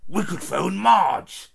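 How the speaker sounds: a pompous uncle floating an idea; heavy, deliberate suggestion; Uncle Vernon suggesting. Acted. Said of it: "Uncle Vernon suggested".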